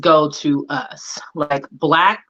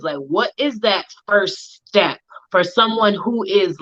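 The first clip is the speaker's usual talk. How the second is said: normal talking voice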